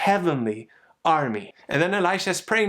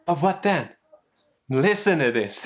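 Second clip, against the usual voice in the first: ordinary talk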